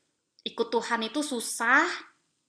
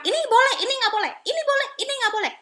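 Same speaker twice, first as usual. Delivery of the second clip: high, rapid sing-song list of rules; mock rule-reciting; 13 semitones higher, 6 dB louder, much faster than usual. Parent listing what is allowed, mock strictness. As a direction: rattle off dos and don'ts, pitched way up.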